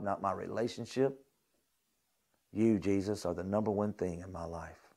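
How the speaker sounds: intimate prayer. Shown as a demonstration. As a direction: let it sink to a hush. Continues the quiet prayer.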